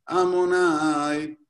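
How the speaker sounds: slow, drawn-out Amonai, Amonai; deliberate unhurried Amonai with a pause; slow separated Amonai. Shown as a demonstration. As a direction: stretch each Amonai, not rápido. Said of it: "no puede decirlo rápido".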